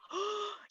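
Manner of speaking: sharp, theatrical gasp of awe; awed gasp. Retold or imitated. Recalling an opera singer's huge voice, an awed gasp. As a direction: gasp as if stunned by a great voice.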